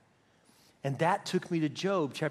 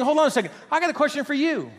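loud, high challenge; God interrupting; 10 semitones higher, 5 dB louder, faster than usual. Acted. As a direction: cut in and turn the questioning round. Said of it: "maybe saying".

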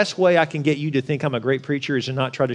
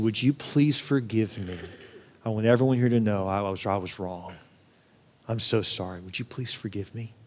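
soft, lowered plea for forgiveness; tearful plea; 4 semitones lower, 7 dB quieter than usual. Retold or imitated. Professor to student before the whole class, quiet contrite plea. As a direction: ask humbly and quietly, sorrow held in. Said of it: "with tears in his eyes".

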